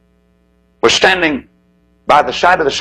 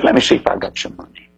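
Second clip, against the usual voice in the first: a hushed breathy whisper; whispered aside; 2 semitones lower, 4 dB quieter than usual. Acted. Stranger checking his pockets for the crying boy, whispered. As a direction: murmur it to yourself, just air.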